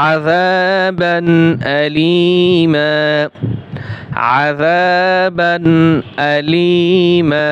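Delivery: very slow chanting of আযাবান Alima with long vowels; slow drawn recitation. Shown as a demonstration. Teacher reciting the joined phrase for students, slow and drawn. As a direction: stretch each word like tarteel. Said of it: "এরকম টান হবে".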